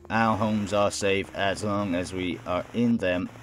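monotone public service warning; robot reading out an announcement; robotic announcer. Acted. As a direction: deliver it evenly like an automated broadcast. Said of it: "The voice is robotic".

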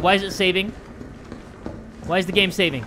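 normal talking voice

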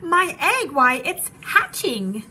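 high, loud, thrilled outcry; loud astonishment, drawn out at the end; amazed shout. A narrator's reading. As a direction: shout it, stretching the final word in wonder. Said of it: "shouted Horton".

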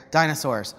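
normal talking voice

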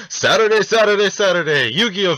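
loud, high monster truck rally announcer. Acted. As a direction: hype it like a Sunday monster truck ad. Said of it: "Doing the old Monster Truck Rally voice".

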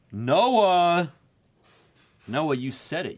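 loud, high cry of astonishment; excited shout. Real, not acted. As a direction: call out to a friend in amazed disbelief. Amazed that Noah called the card.

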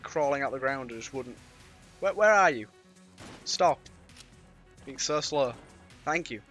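ordinary talk, nothing marked

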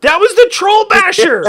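high, loud startled exclamation; excited shout. Real, not acted. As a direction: shout it out in shock. Surprise at troll attack.

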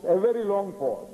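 normal talking voice

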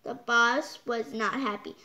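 usual speaking voice

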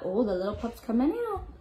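ordinary delivery, nothing marked

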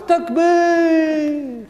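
high, drawn-out takbir call. Acted. The guy calls takbir to crowd.

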